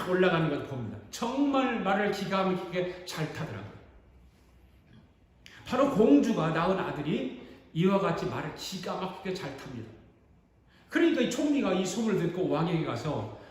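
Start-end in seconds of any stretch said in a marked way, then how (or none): none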